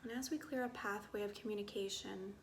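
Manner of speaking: soft, unhurried meditation voice with long pauses; guided meditation. Acted. Guide inviting listeners to get curious about body sensations, soft meditative calm.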